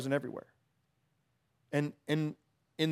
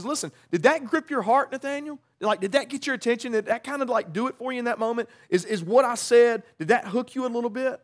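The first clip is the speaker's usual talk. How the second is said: playful excitement; 6 semitones higher, 3 dB louder than usual. Retold or imitated. Jesus voiced teasing Nathanael.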